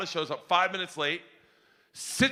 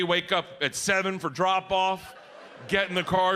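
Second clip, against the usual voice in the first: usual speaking voice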